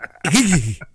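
mouth-made starter pull noise; loud sputter of a motor not catching; imitated engine start. Storyteller starting a stubborn weed eater, imitated engine noise.

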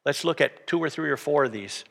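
ordinary delivery

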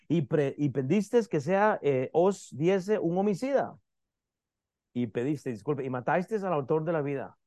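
measured reading from Acts; scripture reading. Acted. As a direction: read the passage steadily to the congregation. Reads scripture aloud to congregation.

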